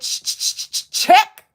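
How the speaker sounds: loud, high call for the check; stammer, then yell it out in mock eagerness; hammed-up urgent shout; eager mock shout. Acting out eager man calling for check.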